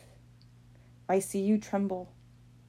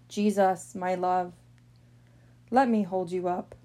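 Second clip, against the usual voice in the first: normal talking voice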